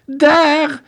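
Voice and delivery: high, loud sobbing soldier cry; hoarse cry. Acted. Soldier throwing his boots at the detective, loud high cry. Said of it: "riep hij hees".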